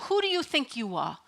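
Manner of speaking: put-on a light Brooklyn accent on the put-down; family put-down in a mild Brooklyn accent. Imitating how family kept her in her place, Brooklyn accent. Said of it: "In the Brooklyn accent".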